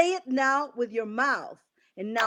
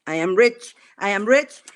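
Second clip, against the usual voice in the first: firm, louder declaration of the words; louder affirmation; 2 semitones lower, 7 dB louder than usual. Shown as a demonstration. Coach leads the group in a louder affirmation. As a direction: say it out loud with conviction. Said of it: "And now say".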